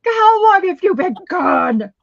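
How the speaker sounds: high, loud scolding of the dog. Retold or imitated. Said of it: "I was so mad at him".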